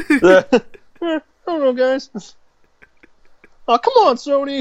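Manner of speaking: high, whiny stammering plea; high mock pleading; nervous Tom Holland. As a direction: whine at Sony nervously.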